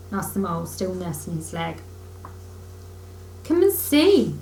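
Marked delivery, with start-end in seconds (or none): 3.4-4.4 higher, bright with glee; delighted. A narrator's reading. Rat calling Mole over with delight. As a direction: beckon with delight. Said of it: "said the delighted Rat".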